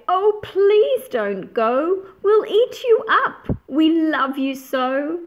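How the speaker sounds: high, loud, slow begging; pleading monsters. Acted. Wild things begging Max to stay, high pleading cry. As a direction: beg him not to leave. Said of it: "But the wild things cried".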